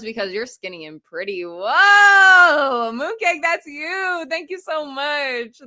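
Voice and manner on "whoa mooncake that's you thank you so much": high, drawn-out gushing gratitude; excited thanks. Real, not acted. Streamer thanking Mooncake for the support, excited gratitude. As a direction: start on a loud, long whoa, then gush slowly.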